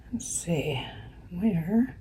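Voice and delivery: very soft, near-whispered mumble; muttering to self. Real, not acted. Host hunting for a viewer's comment, muttering to herself. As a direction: think aloud under your breath.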